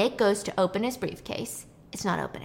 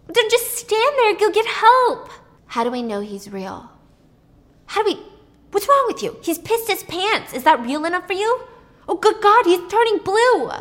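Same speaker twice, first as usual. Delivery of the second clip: very high, loud berating; furious disbelief; 9 semitones higher, 6 dB louder than usual. Retold or imitated. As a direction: berate him for doing nothing. Waitress berates passive Nick.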